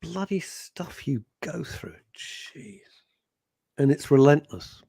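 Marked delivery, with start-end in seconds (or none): 0.0-2.8 near-whispered sympathy; hushed dismay. Real, not acted. Co-host reacts to a friend's bad news, hushed dismay. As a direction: breathe it out softly, almost voiceless.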